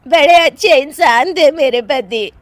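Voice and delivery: high, loud, slow lament; tearful wailing. Acted. Widow mourning her husband, tearful wailing. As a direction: wail slowly in hammed-up grief. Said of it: "वहां पर सब रो रहे होते हैं।".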